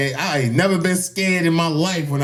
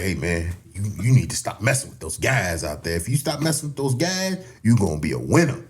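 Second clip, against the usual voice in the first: daddy talk; 6 semitones lower, 4 dB quieter than usual. Retold or imitated. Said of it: "he gave you the daddy talk".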